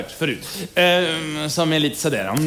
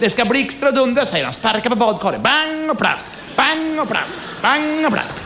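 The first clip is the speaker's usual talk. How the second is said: high, eager urging; a much higher, giddy demand for storm noises; excited groom; 8 semitones higher than usual. Acted. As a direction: egg her on, thrilled.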